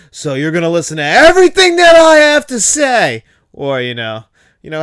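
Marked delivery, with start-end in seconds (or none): 0.1-3.2 much higher and louder tirade; mock drunken rant. Quoting the wedding singer ranting into the microphone, hammed-up yell. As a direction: belt it out like a drunk singer with the mic.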